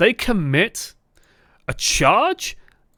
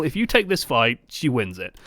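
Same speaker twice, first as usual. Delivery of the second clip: normal talking voice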